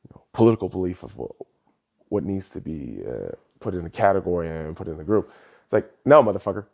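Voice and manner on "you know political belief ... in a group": much lower, softer, droning mock of Steven Crowder. Vlogger mocking Steven Crowder's claim of no beliefs, low and droning. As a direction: mumble it low like a dismissive pundit. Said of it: "Steven Crowder was getting on people with".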